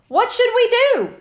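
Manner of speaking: high, showy magician asking the crowd; magician's question. Acted. Magician asks the audience what to do, high and showy. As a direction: ask the crowd like a stage performer.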